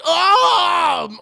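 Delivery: long, loud, high mock "oh"; hammed-up howl of pleasure and pain; groan of pleasure-pain. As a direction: cry out, climaxing and hurting at once. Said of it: "but also in horrific pain".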